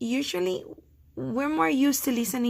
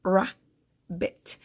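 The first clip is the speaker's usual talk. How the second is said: "rabbit" as "ra ... bit", long gap between; rabbit, one syllable at a time; split into syllables; 2 semitones lower than usual. Shown as a demonstration. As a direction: break rabbit slowly in two. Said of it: "vowel sounds do you hear".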